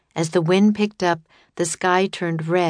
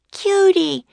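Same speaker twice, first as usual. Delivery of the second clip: put-on high, loud girl's cry; Annie crying out; 14 semitones higher, 6 dB louder than usual. A narrator's reading. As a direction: call out on spotting your camel. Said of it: "cried Annie".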